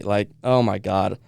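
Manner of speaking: usual speaking voice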